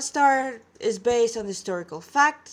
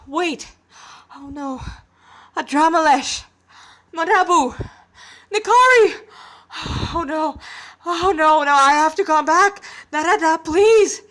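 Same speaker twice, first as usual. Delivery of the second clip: frantic shouts, much higher and louder; yelling names in rising panic; panicked crying out; 6 semitones higher, 10 dB louder than usual. Acted. Boy remembers his sister's fate.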